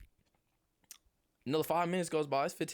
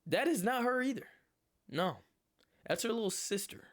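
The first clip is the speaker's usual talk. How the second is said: much higher, shocked; disbelief; 10 semitones higher than usual. Real, not acted. Sees the wrong girl come out, shocked and high. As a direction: voice shoots up in disbelief.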